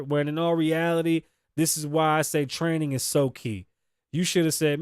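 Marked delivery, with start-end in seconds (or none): none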